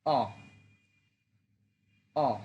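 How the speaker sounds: অ short sharp fall; Mandarin fourth tone. Shown as a demonstration. Teacher drills the fourth tone on অ, falling. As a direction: clip অ off falling. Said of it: "fourth tone".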